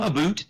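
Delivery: "a boot" said with a long oo; mock Canadian vowel in "boot"; Canadian "about". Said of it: "hint of Canadian in there".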